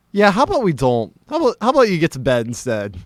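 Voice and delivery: imitated dad, starting high and loud; high, emphatic suggestion, then quickening; dad redirecting drunk. Dad steers drunk guest to bed, firmly humoring.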